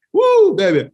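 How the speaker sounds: high, loud whoop of heat; excited whoop. Real, not acted. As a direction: yell it out at the burn. Reacting to very spicy chicken.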